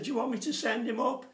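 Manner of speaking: husband calling upstairs to his wife, loud and cheeky. Retold or imitated. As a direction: tease her, calling up the stairs.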